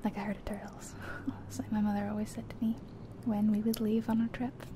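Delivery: ordinary delivery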